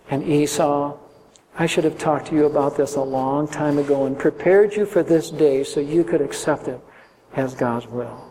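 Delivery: father quietly saying sorry to his wife and sons; tender apology. Pretend. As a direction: apologise humbly to the family. Isaac apologises tenderly to family.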